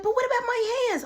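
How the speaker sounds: high-pitched put-on protest; high, whiny imitation; mimicked roommate. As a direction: object in a very high voice. Kayla's voice, protesting.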